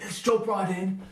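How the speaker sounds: put-on high, excited Australian accent; hammed-up excited Aussie voice. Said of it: "if I wanted to have an accent".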